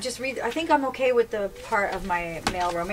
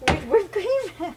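disbelieving; 7 semitones higher, much slower than usual. Real, not acted. Repeating the line in disbelief.

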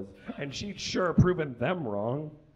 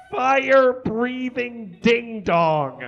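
slow punchline; 8 semitones higher, 6 dB louder, much slower than usual. Acted. Reader hamming up the punchline for a laughing crowd, slow and loud.

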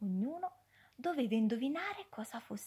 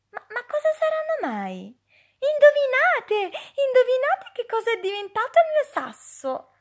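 much higher and louder, fast; excited challenge; 12 semitones higher, 10 dB louder than usual. Acted. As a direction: dare the friends to guess. Child challenges friends to guess. (sfida entusiasta, acuta e veloce)

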